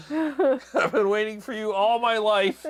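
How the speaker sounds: high, slow, hammed-up declaration; mock longing triumph. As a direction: draw it out in pretend rapture. Continues the jokey Rambo fantasy.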